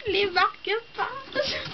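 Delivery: high, drawn-out coo of delight; real high squeal of joy. Real, not acted. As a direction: squeal it slowly, thrilled. Delight on opening bookmark gift.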